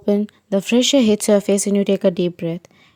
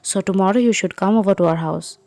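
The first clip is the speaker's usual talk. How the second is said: plain reading voice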